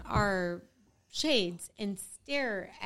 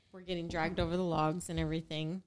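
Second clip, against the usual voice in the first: normal talking voice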